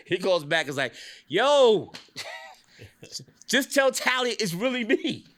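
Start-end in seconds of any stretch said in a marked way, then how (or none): 1.3-5.2 high, loud, drawn-out mock holler; hammed-up yelling into the phone; imitating phone yelling. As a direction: holler down the line like an insistent caller. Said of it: "he's yelling in the phone".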